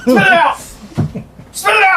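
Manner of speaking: high panicked shout. Real, not acted. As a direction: yell it in real alarm. Friend spitting marshmallows.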